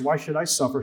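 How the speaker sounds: ordinary talk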